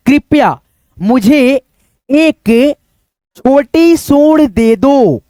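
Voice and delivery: slow, careful pleading read; slow reading aloud. Acted. Teacher reads the elephant's plea slowly to children. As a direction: read the elephant's request word by word.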